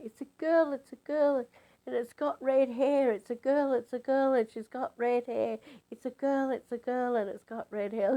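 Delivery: high, breathless-with-news chanting repetition; overexcited. Retold or imitated. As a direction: re-enact being swept up in excitement. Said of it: "it was just the excitement of it".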